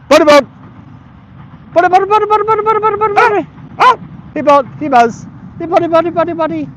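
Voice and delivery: very high, loud, rapid-fire calling; high-pitched dog calling. Real, not acted. Owner calling his dogs over, loud high-pitched excited call. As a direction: squeal it out to get the dogs running.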